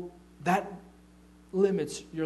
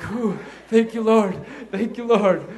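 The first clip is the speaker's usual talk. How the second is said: loud, high cry of thanks; huge relief; 7 semitones higher, 12 dB louder than usual. Acted. Thanking God that the dates don't clash, huge relief. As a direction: burst out in laughing relief.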